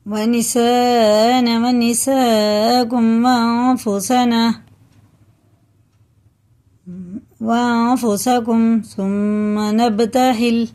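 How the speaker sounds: slow melodic chanted recitation; Quran recitation. Acted. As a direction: chant the verse slowly with long vowels.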